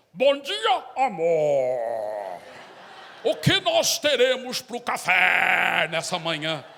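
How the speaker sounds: booming, pompous mock-solemn husband; absurdly stentorian morning greeting; changed tone of voice. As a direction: declaim breakfast small talk like a grand prayer, very loud and drawn out. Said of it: "muda o tom de voz".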